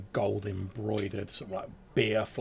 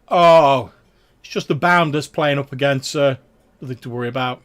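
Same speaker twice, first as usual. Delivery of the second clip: much louder, dismissive guard; 5 semitones higher, 14 dB louder than usual. Acted. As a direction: wave it off loudly. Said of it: "he's like".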